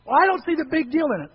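mock protest from the pews; dismissive objection. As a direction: play a listener shrugging off the warning. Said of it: "I know you don't see".

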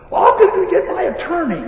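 high, loud customer outburst; angry threat. Retold or imitated. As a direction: imitate an irate customer threatening.

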